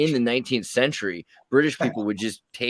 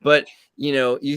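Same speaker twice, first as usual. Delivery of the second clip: normal talking voice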